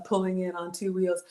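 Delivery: ordinary talk, nothing marked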